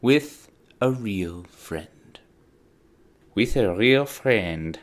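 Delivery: slow, drawn-out put-on voice. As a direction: repeat it slowly in an odd voice. Repeats the line in put-on voices.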